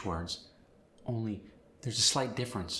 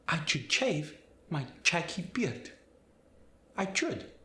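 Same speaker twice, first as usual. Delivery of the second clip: Spanish accent, sh as ch in should, shave, shaggy, higher; 6 semitones higher than usual. Shown as a demonstration. Teacher demonstrating the sh to ch change, Spanish accent. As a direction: swap every sh for ch, Spanish style. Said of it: "S H sound is gonna change".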